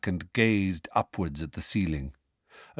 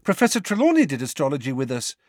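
fast, eager schoolgirl voice; excited; 4 semitones higher, faster than usual. Acted. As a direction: rush it out, keen to show off. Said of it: "said Parvati excitedly".

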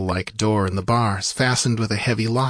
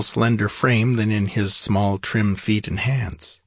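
plain reading voice

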